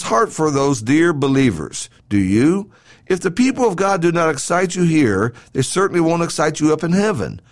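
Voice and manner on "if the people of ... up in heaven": ordinary talk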